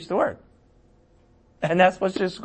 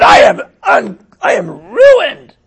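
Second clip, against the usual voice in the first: high, very loud drawn-out wail; anguished cry; 13 semitones higher, 11 dB louder, slower than usual. A narrator's reading. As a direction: cry it out slowly at full voice. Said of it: "he cried".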